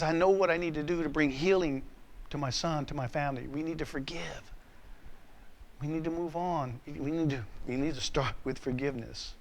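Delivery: soft, halting confession voice; hushed earnest resolve. Retold or imitated. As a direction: retell a humbled man's quiet plea. Retold remorseful uncle pleading to forgive.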